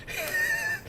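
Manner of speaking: sudden shrill burst of laughter; high squealing laugh. Real, not acted. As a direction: crack up at your own impression. Mocking friend's soft talk.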